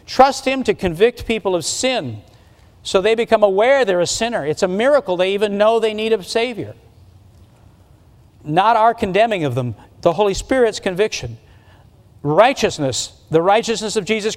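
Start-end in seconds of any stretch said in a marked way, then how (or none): none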